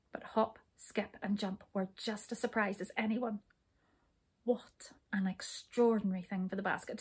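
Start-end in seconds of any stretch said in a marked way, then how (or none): none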